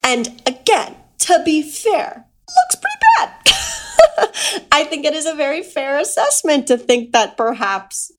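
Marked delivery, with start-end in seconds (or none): 2.5-4.7 high, loud squeaky looks pretty bad; comic squeak. Real, not acted. Judging Dumbledore's rigging, a high, loud squeak. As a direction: squeak it out in mock dismay.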